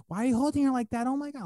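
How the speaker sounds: high, loud, hammed-up outrage; mom making a scene. Mom accusing the helper in public, outraged. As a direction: imitate a mother causing a scene. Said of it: "she was making a fucking scene".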